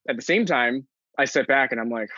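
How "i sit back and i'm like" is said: ordinary talk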